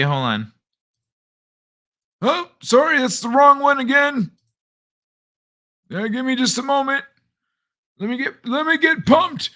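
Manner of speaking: ordinary talk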